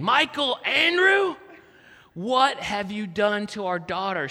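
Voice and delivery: wife demanding answers, drawn out; angry wife. Retold or imitated. Wife waking him at 3am.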